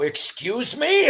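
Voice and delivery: high, drawn-out retold outburst; indignant blurt. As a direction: blurt it out, affronted and rising. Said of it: "I blurted out and go".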